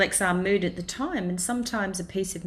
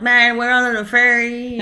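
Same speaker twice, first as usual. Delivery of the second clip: put-on louder, slow, slurring drunk on the phone; drunk on the ferry; 6 semitones higher, 10 dB louder, slower than usual. Radio host mimics drunken ferry passengers, slow loud drunk voice. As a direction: play a tipsy late-night ferry passenger. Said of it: "drunken people".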